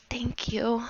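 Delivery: soft, teary thank you; choked up with happy tears; tearful thanks. Acted. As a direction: orphan boy near tears, softly thanking her. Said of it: "he's almost crying happy tears".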